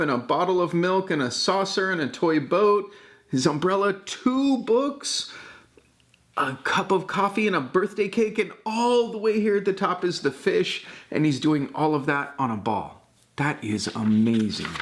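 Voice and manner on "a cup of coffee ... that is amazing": ordinary delivery, nothing marked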